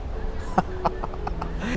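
soft exasperated breath-laugh; a tiny scoff through the nose; breathy chuckle. Real, not acted. Scoffing at fan appeal.

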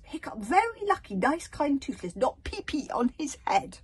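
high, loud little creature voice; indignant tiny dragon; scolding little dragon. A narrator's reading. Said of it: "scolded Toothless".

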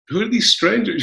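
put-on high, bewildered kid voice; a child eyeing strangers warily; puzzled Scottish kids. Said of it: "they're like".

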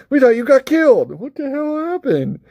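high, hammed-up astonishment; comic high-voiced incredulous friends; mock disbelief. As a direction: pitch it way up in jokey shock. Acts out friends' reaction to Bilbo.

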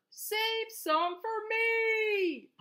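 high, long-drawn singsong plea; farmer's cry. A narrator's reading. Farmer begging animals to leave him apples, drawn-out cry. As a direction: stretch every word high, as a storybook farmer calling out. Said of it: "cried the farmer".

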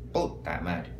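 deep muttered puta madre; low grumbled curse. Acted. Dark muttered reaction after punchline.

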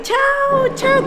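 sing-song goodbye. Retold or imitated. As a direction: mimic the mums' friendly chao, chao. Italian mums saying goodbye warmly.

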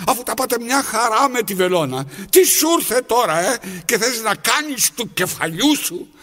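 loud, high, fed-up tirade; angry scolding. Acted. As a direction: chew out the disobedient thread. Scolds the thread at length.

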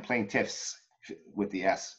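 "plaintiffs" with a clear S at the end; final S sounded. Shown as a demonstration. Coach demonstrating correct plural: "plaintiffs" with audible final S. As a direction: land the S on "plaintiffs". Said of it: "your S's at the end".

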